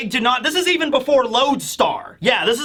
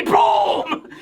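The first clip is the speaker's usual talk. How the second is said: boom; 6 semitones higher than usual. Retold or imitated. Kick sound effect.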